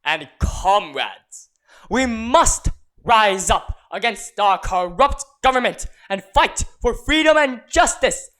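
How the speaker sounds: loud, rousing rally cry; fiery revolutionary speech. Acted. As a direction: stir up the crowd to revolt.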